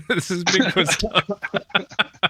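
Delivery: words breaking up into high laughter; laughing. Real, not acted. Imagining friend presenting samples to Fish and Game, laughing. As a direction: crack up helplessly while mock-quoting.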